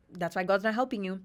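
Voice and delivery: ordinary delivery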